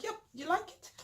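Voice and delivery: high, pleased auntie voice; bright high imitation of auntie showing off the scarf; imitating auntie. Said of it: "and be like".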